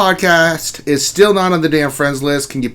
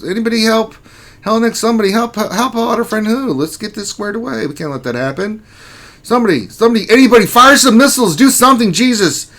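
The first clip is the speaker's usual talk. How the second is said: high-pitched, hammed-up panic; mock desperate plea; 9 semitones higher, 4 dB louder, faster than usual. Streamer begging chat to rescue a guild, mock desperate. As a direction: build from pleading to a loud cry for action.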